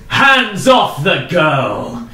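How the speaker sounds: slow, loud command; heroic knight demand. Acted. Knight bursting in to the lord, slow loud command. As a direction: bark it like a hero.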